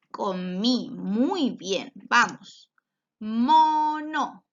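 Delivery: usual speaking voice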